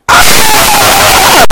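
loud, high open-throated scream; long reenacted scream down a drop. Thrill-seeker gunning it down a steep hill, screaming. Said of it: "I would scream".

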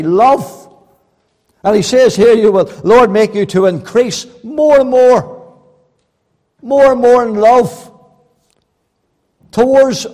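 loud, ringing pulpit delivery; fervent sermon voice; full-force preaching. Acted. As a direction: preach it out to the whole hall. Preacher reading scripture at full force.

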